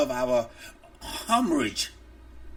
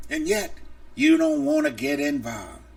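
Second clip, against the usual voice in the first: ordinary talk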